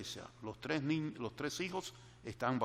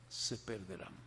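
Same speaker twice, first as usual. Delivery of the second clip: hushed grave repeat; 4 semitones lower, 7 dB quieter than usual. Acted. Repeated warning, dropped low.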